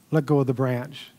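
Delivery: low, softly voiced voice of God; voice from heaven. Voice from heaven answering the man's prayer, low and calm. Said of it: "a voice from the heavens said".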